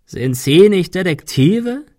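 slow, high croaked question; drawn-out, loud mocking rasp; croaking villain. A narrator's reading. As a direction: croak it with scornful surprise. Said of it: "krächzte er".

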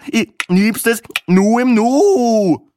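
high, loud, very slow "ich nips es nur im Nu" falling at the end; drawled punchline dropping on "Nu"; joke punchline. Acted. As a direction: stretch it out and land "Nu" falling.

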